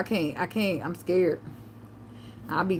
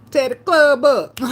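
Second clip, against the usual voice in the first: louder, slow, hyped-up shout; 6 semitones higher, 8 dB louder, slower than usual. Retold or imitated. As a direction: shout it like you're ready to party. Hyped about her drink.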